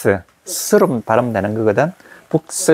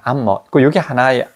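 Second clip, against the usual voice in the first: ordinary talk, nothing marked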